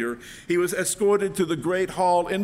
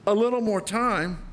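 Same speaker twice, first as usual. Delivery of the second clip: mocking king; 6 semitones higher than usual. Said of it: "Can you hear the king's laughter?".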